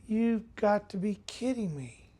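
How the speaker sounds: slow, hushed, stunned disbelief. Retold or imitated. Father realizing his baby grows up without him, hushed disbelief. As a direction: let it out softly and slowly, stunned.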